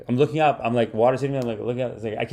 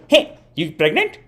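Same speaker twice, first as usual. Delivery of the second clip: higher-pitched mom impression; blunt mom; 7 semitones higher than usual. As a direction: imitate a tactless mother asking, voice raised in pitch. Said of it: "my mom sees her at a party".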